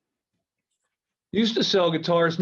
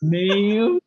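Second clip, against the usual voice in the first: Southern ma'am; 4 semitones higher, 4 dB louder than usual. Retold or imitated.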